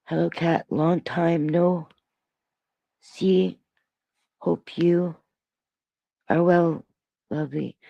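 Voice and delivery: measured, lightly British reading; gentle put-on British accent; British accent, not Cockney. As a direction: read it slowly in a mild British accent. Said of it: "do it in a British".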